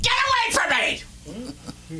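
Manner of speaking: high, slow, hammed-up rebuff; mock snapping mom. Mom snapping at her son who offers a blanket. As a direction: push the helper away dramatically.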